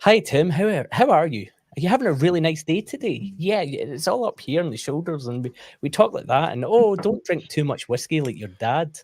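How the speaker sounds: put-on high, bright classroom voice for kids; sing-song teacher talking to little pupils; teacher [name] voice. Shown as a demonstration. Teacher greeting a young pupil in class, high and sing-song. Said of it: "So I can do my teacher [name] voice".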